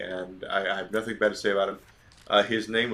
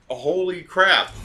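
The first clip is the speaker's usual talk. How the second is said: higher, louder and slow, caught off guard; stunned realization; 7 semitones higher, 5 dB louder than usual. Retold or imitated. Son realizes his dad confronted the wrestling champ, stunned. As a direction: gasp it out like you just saw something dangerous.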